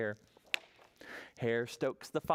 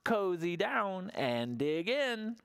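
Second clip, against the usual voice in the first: Badger voice; 8 semitones higher, slower than usual. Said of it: "Badger says with a grin".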